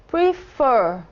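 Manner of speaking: "prefer" with the second syllable strong; stress on last syllable. Shown as a demonstration. Teacher showing learners the stressed syllable, "prefer" stressed at the end. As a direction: say "prefer" slowly, leaning on the final syllable. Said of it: "a última sílaba é a sílaba mais acentuada".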